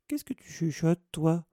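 put-on high, sharp ghost-girl demand; suspicious challenge. A narrator's reading. Ghost challenges the whispering boy. (question aiguë et méfiante)

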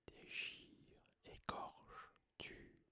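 far-off, voiceless menacing whisper; cold deadly voice. A narrator's reading. Hidden monster in the walls hissing for blood, whispered. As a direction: breathe the threat like an unseen monster. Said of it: "froide et mortelle".

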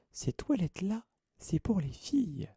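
put-on high-pitched, suspicious ghost girl; suspicieuse. A narrator's reading. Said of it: "d'un air soupçonneux". (voix aiguë et soupçonneuse)

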